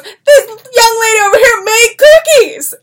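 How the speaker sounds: yelling across the office. Retold or imitated. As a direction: announce it across the bullpen. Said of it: "he's yelling across the bullpen".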